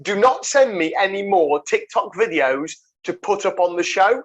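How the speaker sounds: ordinary talk, nothing marked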